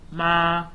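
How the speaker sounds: ma held high and level, first tone. Shown as a demonstration. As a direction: sing ma on one high flat pitch. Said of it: "So you have the high tone".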